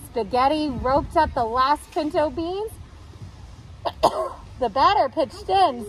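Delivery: ordinary delivery, nothing marked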